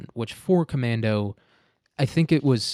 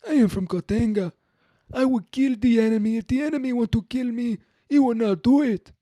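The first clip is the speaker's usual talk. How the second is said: put-on much higher and louder, heavy African accent; African militia man voice; 12 semitones higher, 4 dB louder than usual. Host imagines a Katanga militiaman bragging, heavy African accent. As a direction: boast like a mock African warlord. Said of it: "my African militia man voice".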